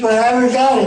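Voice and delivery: mock helpless client. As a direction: whine it like a broke client.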